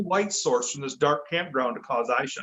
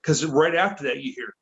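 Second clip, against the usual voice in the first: usual speaking voice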